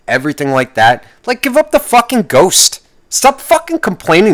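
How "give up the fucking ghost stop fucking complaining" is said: high, hard-hit command; angry outburst. Real, not acted. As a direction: snap it at them. Rant peak.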